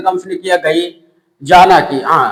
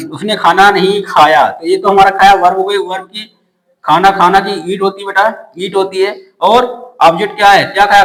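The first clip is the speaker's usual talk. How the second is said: normal talking voice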